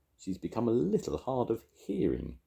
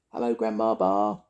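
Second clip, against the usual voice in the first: higher little mouse voice; squeaky mouse; 5 semitones higher, 4 dB louder than usual. A narrator's reading. Tiny mouse greeting Grandma, squeaky. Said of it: "he squeaked".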